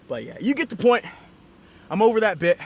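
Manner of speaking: breathless, catching breath. Real, not acted. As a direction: talk while winded. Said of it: "I'm out of breath".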